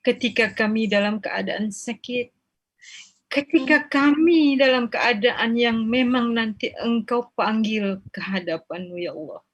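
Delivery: measured chanted supplication with long pleading pauses; leading a prayer. Acted.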